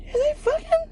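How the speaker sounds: incredulous high-pitched question; high disbelieving question. Retold or imitated. Security guard staring at the couple, asks in a high squeak.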